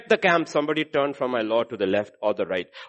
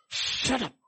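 sharp mock rebuke; 10 semitones higher, 4 dB quieter than usual. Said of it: "In other words".

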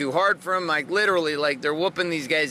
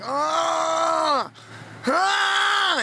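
long, high imitated groan of agony; drawn-out, hammed-up "aah" of exhaustion; mock groan; 11 semitones higher than usual. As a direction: groan dramatically, mimicking a sufferer. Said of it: "people later in the event would be groaning".

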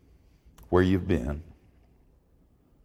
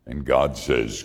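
normal talking voice

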